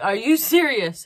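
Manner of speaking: slow, loud are-you-serious; stunned mock disbelief; mock astonished disbelief. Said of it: "She's like".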